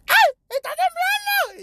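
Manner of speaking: imitando a la madre, agudísimo y chillón, lento; un grito fingido de pánico de mamá; madre gritando asustada. Retold or imitated. (imita a su mamá gritando de miedo por el temblor)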